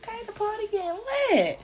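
high and fast, getting excited; hyped up. Retold or imitated. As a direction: feel the party heating up. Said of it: "So I'm like".

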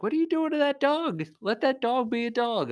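high mock voice of a scolding stranger; judgy passerby. Stranger scolding owner pulling dog away, high mocking voice. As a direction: squeak it like an outraged bystander. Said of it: "I'm sure people are like".

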